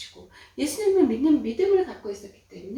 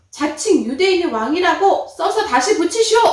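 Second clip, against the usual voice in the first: loud, higher shouted order; mocking demand; 6 semitones higher, 10 dB louder than usual. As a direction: bark it like a scornful mob. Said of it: "이렇게 얘기하는 것이었어요".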